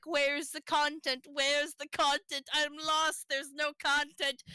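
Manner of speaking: mock whiny viewer. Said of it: "then you'll be like".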